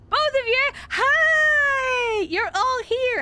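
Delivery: high, loud squealed greeting; thrilled high-pitched welcome; delighted squeal. Real, not acted. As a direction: squeal with joy at both arriving. Squeals greeting two viewers.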